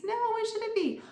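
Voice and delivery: high, hammed-up question to kids; playful prompting. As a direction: ask the class in a bright teacher voice.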